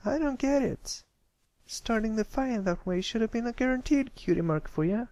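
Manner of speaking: high filly voice, baffled; puzzled country filly. Acted. Apple Bloom confused there is no cutie mark, high and baffled. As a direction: wonder aloud why it failed.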